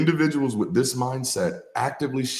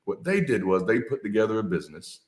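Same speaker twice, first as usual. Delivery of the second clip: ordinary talk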